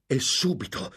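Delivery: high, furious exclamation; beside himself. A narrator's reading. Lorenzo vowing to make him pay, beside himself. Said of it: "esclamò Lorenzo fuori di sé".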